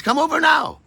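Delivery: [name] imitates Maggie calling him to come over now, pitched high; eager Maggie voice.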